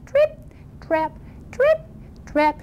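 little high-pitched trip-trap; tiny light hoofsteps, spaced apart; small, dainty goat footfalls; teeny tiny footsteps. Acted. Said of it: "he made a teeny tiny bridge, little noise like this".

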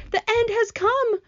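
very high, slow lament; despairing cry. A narrator's reading. Miss Spider sure they are doomed, wailing high. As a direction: cry out doom, high and drawn out. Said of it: "Miss Spider cried".

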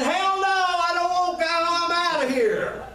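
man shouting his refusal; defiant shout. Acted. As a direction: throw it back at God, defiant. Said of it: "But man said".

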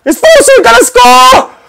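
very high, loud screaming; goal celebration scream. Real, not acted. Gamer screaming as his debutant scores. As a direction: scream it like a last-minute winner.

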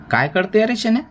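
high, rising taunting question; high-pitched sneering question; scornful challenge. A narrator's reading. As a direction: demand it scornfully, pitched right up. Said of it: "शेठा मान वाकडी करून म्हणाला".

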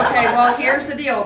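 loud laughing start; amused mom. Real, not acted. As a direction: laugh as you start explaining. Mom laughing then explaining.

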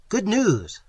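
high, loud street cry; excited herald's shout; shouted news. A narrator's reading. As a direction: call it out to the street. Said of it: "a man shouted".

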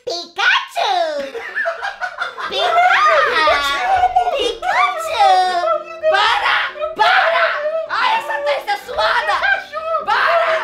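squeaky high Pikachu shout. Pretend. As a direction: shriek like Pikachu. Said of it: "Igualzinho, Pikachu".

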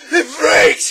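high, full-force strained yell; gasped shout. A narrator's reading. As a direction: yell it as if helpless with hilarity. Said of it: "he grasped".

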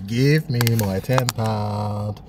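each word drawn out slowly; slow demand. Real, not acted. Man repeating his demand to the cash machine, slow and deliberate. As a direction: spell it out slowly, as if to a dense machine.

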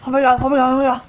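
ordinary talk, nothing marked

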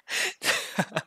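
high-pitched helpless giggle; high tickled laugh. Acted. Being tickled.

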